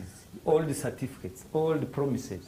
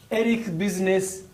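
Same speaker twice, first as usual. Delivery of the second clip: usual speaking voice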